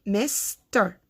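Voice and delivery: "mister" as "misss ... ter"; mister, slowly broken in two; split into syllables. Shown as a demonstration. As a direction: hold the S of mister before "ter". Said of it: "Mister has two syllables".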